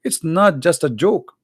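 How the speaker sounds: ordinary delivery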